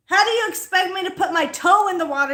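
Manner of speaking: fast, high, loud protest; worked-up rapid complaint; indignant rant. Real, not acted. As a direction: demand an answer, outraged. Arguing with partner about water.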